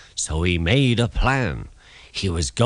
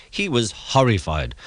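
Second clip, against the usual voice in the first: ordinary delivery, nothing marked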